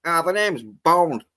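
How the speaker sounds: slow, drawn-out Australian Bond line; lightly hammed-up Aussie drawl; a hint of Australian accent on the famous line; mock Australian Bond. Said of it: "Lazenby would have been like".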